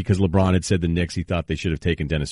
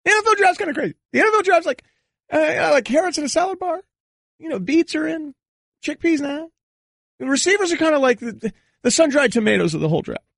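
much higher put-on voice, rambling; Cowherd impression. Radio host mocking a fellow host on the draft, high put-on voice. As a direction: ham up a pundit's odd food comparisons. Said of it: "I could do Cowherd all right".

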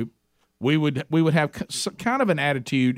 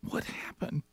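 whispered in shock; 13 dB quieter, slower than usual. Retold or imitated. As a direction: breathe it out, stunned. Acted shocked reaction.

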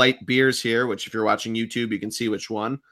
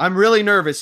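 nervous; 7 semitones higher, 4 dB louder than usual. Real, not acted. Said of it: "if you can't tell from the tone of my voice".

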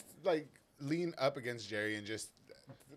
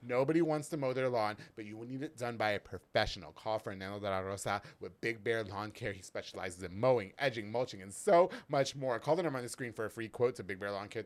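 ad read; 5 dB louder than usual. Acted. Sponsor ad read.

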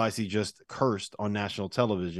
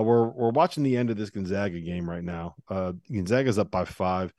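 normal talking voice

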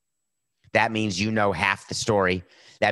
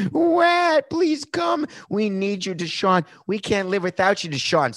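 very high, whiny squeal of a team begging its quarterback; mock desperate pleading; 12 semitones higher, slower than usual. Mocking a team that can't live without its star, high whiny pleading. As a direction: beg in a falsetto, hamming up the desperation.

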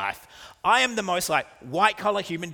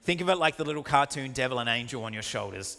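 ordinary talk, nothing marked

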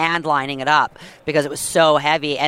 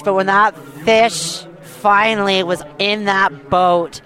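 usual speaking voice

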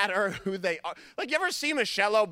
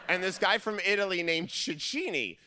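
ordinary delivery

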